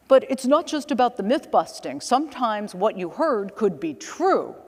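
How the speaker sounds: put-on retelling the lawyer in a failing Long Island accent; the lawyer pitching a content idea, in a half-failed Long Island accent. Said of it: "I'm trying to do the accent".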